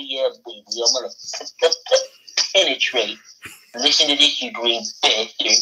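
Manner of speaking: lightly voiced droopy cartoon hound; understated cartoon dog gloating. Hound gloats at the frog rival, lightly droopy voice. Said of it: "Bend over for Droopy".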